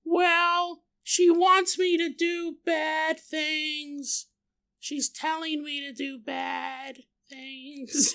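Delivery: put-on a frightened kid confessing; scared little girl. Niece Samantha confides in Uncle Paul, slow and high. Child voiced in retold story.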